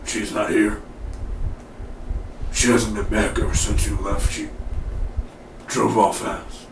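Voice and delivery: low-key put-on voice, halting delivery; Bear. Bear answering a question about Simone, put-on halting voice. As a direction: play him terse, long gaps between phrases. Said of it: "And Bear's like".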